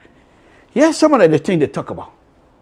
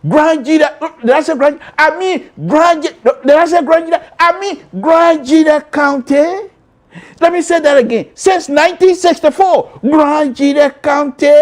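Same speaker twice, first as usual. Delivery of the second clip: announcer intro; 12 semitones higher, 4 dB louder than usual. Acted. Host proudly announces his home county to viewers. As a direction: tease the audience, then crown the county name. Playful hyped hometown announcement.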